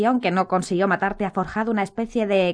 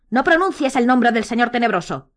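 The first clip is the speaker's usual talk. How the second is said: higher, louder snapped order; 6 semitones higher, 5 dB louder than usual. A narrator's reading. Snape forbidding Harry the Dark Lord's name, snapped loudly. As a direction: bite the order out at him. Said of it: "le espetó Snape".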